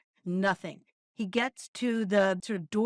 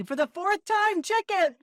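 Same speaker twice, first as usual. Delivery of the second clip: high, louder mock exasperation; mock exasperated; 10 semitones higher, 4 dB louder than usual. Quoting the punchline between friends, mock exasperated. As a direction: toss off the punchline in a fed-up voice. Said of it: "If someone does".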